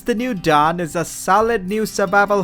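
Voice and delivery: mock posh British reviewer voice, slowing at times; hammed-up British English accent; fake British accent. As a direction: read it like a put-on British critic. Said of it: "trying to sound like a foreign British guy".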